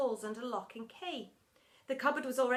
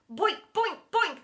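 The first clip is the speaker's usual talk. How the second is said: loud, high comic "boink"; cartoon bonks in quick succession; bouncing noise; 7 semitones higher, 6 dB louder than usual. A narrator's reading. Balls bopping off teacher's head, bouncing noise.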